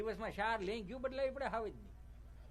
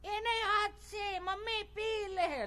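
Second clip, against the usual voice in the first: put-on much higher, slow whiny child voice; lazy kid; 10 semitones higher, much slower than usual. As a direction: drag it out like a spoiled brat glued to the phone. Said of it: "મોબાઈલમાં ડાચું નાખીને શું કહે". (મોબાઈલમાં ડૂબેલા છોકરાનો ધીમો રડમસ અવાજ)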